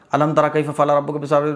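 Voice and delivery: fast, run-together wrong reading; rushed recitation. Shown as a demonstration. Teacher showing the wrong hurried recitation. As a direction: hurry through the verse. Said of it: "یہ غلط ہے".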